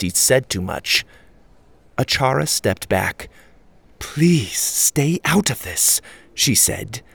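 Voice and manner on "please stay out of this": slow, earnest warning; pleading woman. A narrator's reading. Achara warning the children, slow and earnest. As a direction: urge them to back off.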